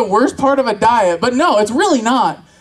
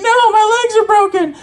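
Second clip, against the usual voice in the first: put-on high-pitched wail of a hurt child; mock screaming; 10 semitones higher than usual. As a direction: cry out from the foot of the stairs, hammed up.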